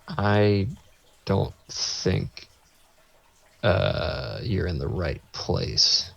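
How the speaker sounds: slow, halting delivery with long pauses; hesitant doubt. Retold or imitated. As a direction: break the news haltingly, unsure. Confused nurse doubts they belong.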